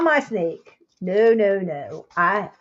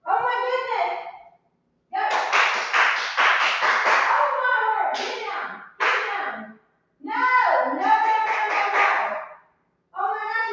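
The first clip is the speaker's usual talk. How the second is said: panicked cries; 8 semitones higher, 3 dB quieter than usual. Real, not acted. Shooing a rat off the deck, panicked. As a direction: cry out in distress, pitched way up.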